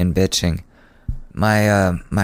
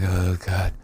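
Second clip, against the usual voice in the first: quiet groan; 5 dB quieter than usual. Retold or imitated. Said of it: "I'm like".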